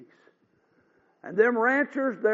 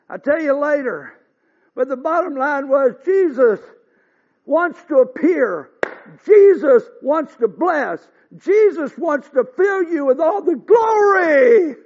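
preaching at full force; 6 semitones higher, 8 dB louder than usual. Acted. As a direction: hammer each word home to the congregation. Preaching climax.

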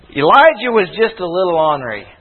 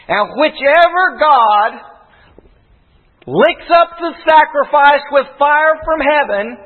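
preaching at full force; 3 semitones higher than usual. Acted.

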